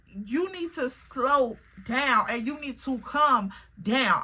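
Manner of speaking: slow, deliberate telling-off; retold firm warning. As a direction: space the words out to get through to him. Retold telling him to calm down.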